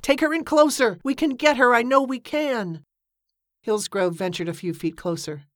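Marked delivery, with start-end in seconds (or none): 0.0-2.8 high, pressing shout; shouted urging. A narrator's reading. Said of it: "he shouted".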